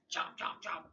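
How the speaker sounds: breathy chomp chomp; unvoiced munching noise; chomping sound. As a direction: mime a horse chewing. Horse eating sound.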